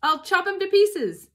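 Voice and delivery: high, loud threatening yell; shouting. A narrator's reading. Bunce threatening the fox, loud high shout. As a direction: yell the threat across the hill. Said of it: "shouted Bunce".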